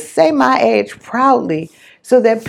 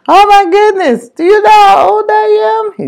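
high, loud, crying out through laughter; laughing shout; 10 semitones higher, 7 dB louder than usual. Retold or imitated. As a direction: hoot it out like he can't stop laughing. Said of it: "And he just cracked up".

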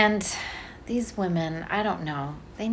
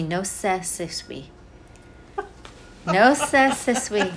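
imitating the nurse's thick accent on No se, se suis; 2 semitones higher than usual. Mother imitates nurse's thick accent explaining baby care. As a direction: mimic the heavy foreign accent of the nurse. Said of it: "she had a very thick accent".

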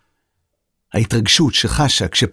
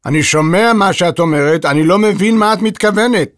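high, insistent protest; irritated puzzlement; exasperated; 7 semitones higher, 4 dB louder than usual. A narrator's reading. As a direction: insist you heard, just don't understand. Beethoven insists he hears her.

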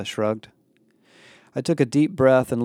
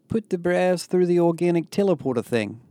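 higher-pitched voice unlike the narrator's; Jade's character voice; 7 semitones higher than usual. A narrator's reading. Jade suggests sending bras through, in high character voice.

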